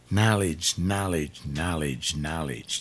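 knowledge, knowledge, sinking to a hush; fading repetition. Acted. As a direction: let the word die away as you repeat it. Preacher repeats the key word.

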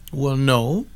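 ordinary delivery

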